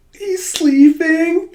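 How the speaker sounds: very high, drawn-out squeal; acted comic astonishment; astonished exclamation. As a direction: stretch it out in high-pitched disbelief. Yona finds the king asleep.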